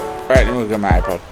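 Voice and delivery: normal talking voice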